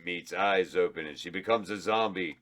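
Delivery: ordinary delivery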